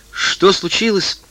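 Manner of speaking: put-on high, frightened child's question. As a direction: ask it scared and high. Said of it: "испуганно спросила Дайна".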